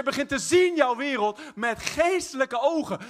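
rousing preacher's crescendo; building preaching. Acted. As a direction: climb toward a shout.

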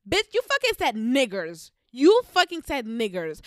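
loud, hammered, slowing on the repeat; angry retort. Real, not acted. Retelling her retort to the boy, loud angry. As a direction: hit back hard at him.

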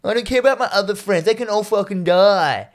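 high, whiny mock tantrum; Rob arguing. Man arguing with army man for his girlfriend, hammed-up pleading. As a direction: wail it in exaggerated desperation. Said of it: "Rob is still arguing with the fucking army man".

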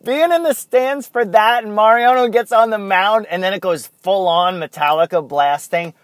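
loud, high-pitched excitement; thrilled. Real, not acted. Fan recalling being in the stands as the closer enters, thrilled. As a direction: gush loudly, reliving the thrill.

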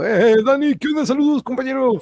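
excited greeting. Real, not acted.

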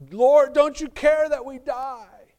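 very loud, high, slow terrified cry; desperate shout. Acted. Disciples in the sinking boat shouting at Jesus, loud panicked cry. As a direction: scream it over the storm. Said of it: "The disciples".

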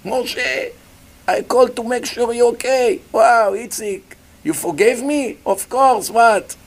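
a relieved brother calling; reconciled brothers. Acted.

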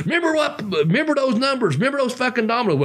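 high, fast, frantic pleading; rattled off in a panic to save the game; hammed-up frantic outburst; going nuts. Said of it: "He starts going nuts".

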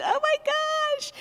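mock nervous gasp; shy flustered self. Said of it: "She went".

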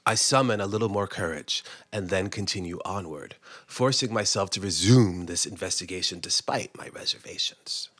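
whispered creeping dread; hushed suspense. A narrator's reading. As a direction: hush it like a thriller. Gathering courage, tense.

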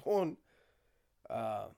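ordinary talk, nothing marked

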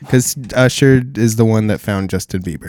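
ordinary talk

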